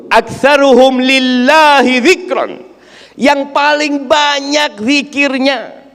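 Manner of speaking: high, slow, ringing sermon delivery; emphatic preaching. Acted. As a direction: proclaim it to the whole congregation.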